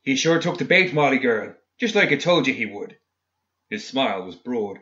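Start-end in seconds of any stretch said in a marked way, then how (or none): 0.0-3.0 loud, high character shout; shouting to her. A narrator's reading. Man tells his companion the bait was taken, shouting. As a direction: shout it across to her, raised in pitch. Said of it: "He shouted to her".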